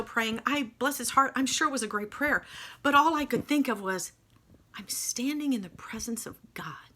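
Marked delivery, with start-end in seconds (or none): 4.7-6.9 breathless whisper of wonder; awed whisper. Retold or imitated. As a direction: whisper it as if God stands before you. Awed inner thought in church.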